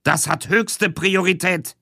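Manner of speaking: chief insisting on priority; stern boss. Acted.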